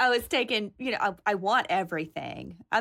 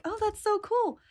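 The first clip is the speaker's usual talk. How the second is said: delighted exclaim; 12 semitones higher than usual. Retold or imitated. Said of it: "Oh, I'm like".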